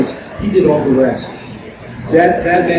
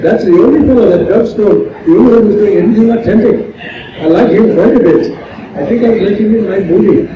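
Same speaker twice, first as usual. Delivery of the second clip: put-on East Indian producer's accent, higher and livelier; 5 semitones higher than usual. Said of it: "an East Indian producer told the lady".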